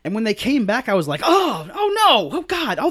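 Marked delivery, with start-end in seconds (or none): 1.2-2.9 high, slow mock groan of dismay. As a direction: drag out the oh no in fake horror. Retold reaction while watching.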